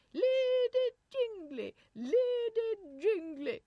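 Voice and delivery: loud, very high, slow English trill; English nurse accent. A narrator's reading. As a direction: call out in fluting English tones. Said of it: "the pure accents of the Sweeney's nurse".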